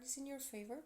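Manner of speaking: ordinary talk, nothing marked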